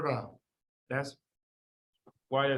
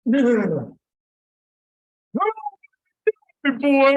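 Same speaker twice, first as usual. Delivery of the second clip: loud exasperated no; 8 semitones higher, 8 dB louder than usual. Real, not acted. Teacher rejects wrong answer.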